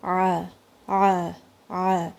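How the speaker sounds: عین pressed from the middle of the throat; deep throat ain; ain sound. Shown as a demonstration. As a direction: squeeze the air for عین. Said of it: "اس کی sound نکالنی ہے".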